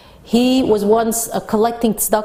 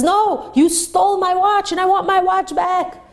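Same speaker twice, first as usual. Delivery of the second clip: insistent accusation; 9 semitones higher than usual. Retold or imitated. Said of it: "And the rich man says".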